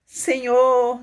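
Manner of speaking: reenacted cry from the soul; anguished cry. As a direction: call out to the Lord in despair. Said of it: "Gritava, minha alma gritava".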